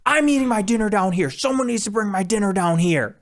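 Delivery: high mock drunk whine; drunk guest yelling. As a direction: imitate a drunk guest demanding dinner. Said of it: "literally yelling at everyone".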